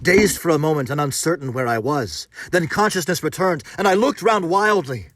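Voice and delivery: loud, high, racing climax; frantic horror. A narrator's reading. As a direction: tell it like you're fleeing death itself.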